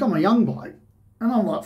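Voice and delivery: ordinary talk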